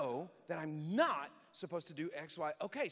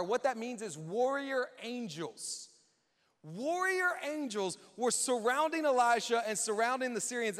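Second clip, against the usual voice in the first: normal talking voice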